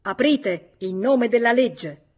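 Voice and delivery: loud, slow police command; the law demanding entry, loud and slow; commanding shout. A narrator's reading. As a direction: bark it through the door with full authority. Police officer demands door open.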